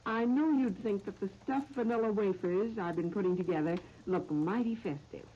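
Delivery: ordinary talk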